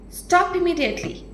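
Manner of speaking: ordinary talk, nothing marked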